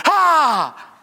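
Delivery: high mock scream of fright; startled yelp. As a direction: imitate a startled child's yelp. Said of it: "loves to be startled".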